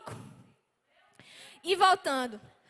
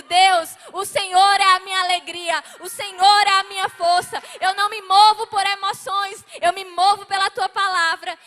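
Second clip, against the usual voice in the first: loud fervent praise, pitch raised; loud declaration; 4 semitones higher, 11 dB louder than usual. Retold or imitated. Crying out to God, a loud fervent declaration. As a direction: proclaim it with full force.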